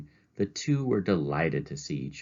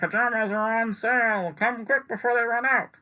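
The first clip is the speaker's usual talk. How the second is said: much higher, eager sales call; high salesman voice; 4 dB louder than usual. Acted. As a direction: hawk the sale like an excited clerk. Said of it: "I imagine he said".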